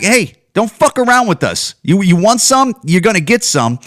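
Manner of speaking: high, forceful tough-guy warning. Acted. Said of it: "when you kinda tell 'em".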